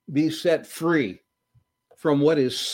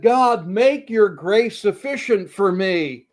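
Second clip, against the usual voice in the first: slow, raised anguished plea; crying out to God; 6 semitones higher, 4 dB louder than usual. Acted. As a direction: beg God slowly from the heart. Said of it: "he cried out to the Lord".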